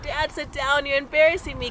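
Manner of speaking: retold embarrassed plea to dad; embarrassed scolding. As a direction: act out a daughter hushing her dad.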